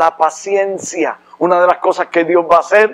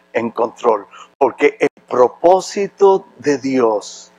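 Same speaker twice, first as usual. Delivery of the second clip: tender preaching; 5 semitones lower, slower than usual. Acted. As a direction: ease down gently to comfort them. Soft slow close over music.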